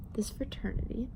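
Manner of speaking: hushed confession; whispered aside. Real, not acted. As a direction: whisper it like a secret. Aside on the frat.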